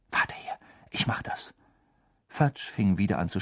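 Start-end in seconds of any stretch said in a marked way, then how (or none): 0.1-1.5 hushed, urgent whisper; secretive whispered instruction. Acted. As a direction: whisper it so nobody overhears. Harry whispers while hiding.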